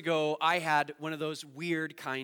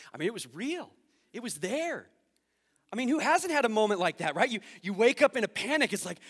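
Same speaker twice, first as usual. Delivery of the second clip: usual speaking voice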